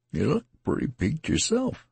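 a concerned country soldier; tattered man. A narrator's reading.

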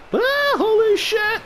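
high, slow yell of shock. Real, not acted. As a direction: cry out as the blast hits. Explosion right beside them.

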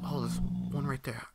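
ordinary delivery

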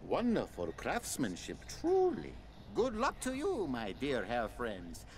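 put-on Italian accent. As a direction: gush like a charming Italian flatterer. Player voices Italian demon Roberto.

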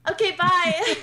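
loud, high brush-off; dismissive. Retold or imitated. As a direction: wave them off sharply. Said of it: "I was like".

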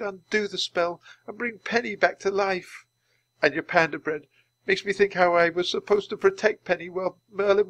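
high-pitched, tearful confession; weeping. Acted. Teddy blames himself for harming Penny, weeping. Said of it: "As Teddy wept".

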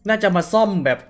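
loud, high, still rattled from the scare; shaken shouting. Real, not acted. Gamer shouting at zombie who scared him, loud and high. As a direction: yell it out while your heart still races.

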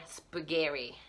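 imitating English speakers saying spaghetti; mock American spaghetti. Italian mocks her English-speaking friends, softly. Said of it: "they were like".

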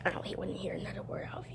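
fast threatening whisper; menacing whisper. Acted. Bully toy threatening the little puppy in a whisper. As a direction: hiss the threat right in his ear.

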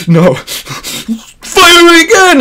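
high, loud, very drawn-out yell; mock refusal. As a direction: scream the order like a hammy commander. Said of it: "he ain't having it".

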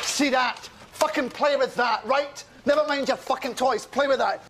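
high-pitched, impatient telling-off; angry order. Acted. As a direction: order them about in a temper. Furious order to an underling.

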